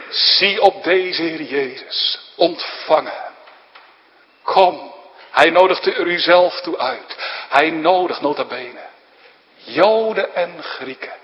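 insistent invitation from the pulpit; preacher calling the congregation to come; urgent preaching. Acted.

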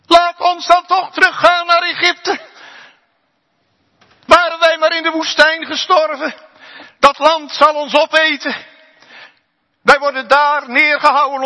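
loud, high complaining crowd; wailing Israelites. Retold or imitated. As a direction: lament and refuse like frightened people. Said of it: "Ze weenden".

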